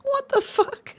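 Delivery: high squeal half laughing, half crying; hysterical laugh-cry. Real, not acted. Streamer laughing at herself, high, half crying. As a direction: lose it between tears and laughter. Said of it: "I think I'm hysterical".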